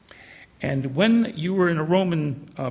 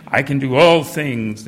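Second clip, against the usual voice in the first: louder, punchy motivational delivery; life coach slogan; 7 dB louder than usual. Retold or imitated. Preacher mimicking a life coach misreading the verse, loud and punchy. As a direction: sell it like a pep-talk slogan. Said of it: "this comes from a life coach".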